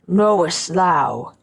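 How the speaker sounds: slow, menacing giant spirit; awful spirit voice. A narrator's reading. Freed spirit towers over the scholar, awful voice. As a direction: draw it out in a dreadful monster voice. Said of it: "he cried in an awful voice".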